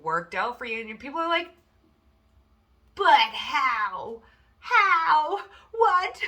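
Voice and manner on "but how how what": squeaky, much higher disbelieving how; mock baffled amazement. People marvel at how things worked out, in high mock disbelief. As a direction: pretend to be stunned it all worked out.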